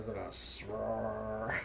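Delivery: ordinary talk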